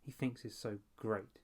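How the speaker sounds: plain reading voice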